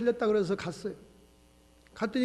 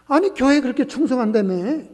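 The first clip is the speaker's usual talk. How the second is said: mocking jeer; 5 semitones higher, 6 dB louder than usual. Neighbors jeer passing the shop.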